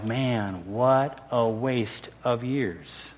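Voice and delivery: low, hushed, drawn-out regret; quiet, weary-slow rueful sigh of words; rueful realization. Retold or imitated. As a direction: let it out slowly and softly, looking back with regret. Regretful realization after years of bitterness.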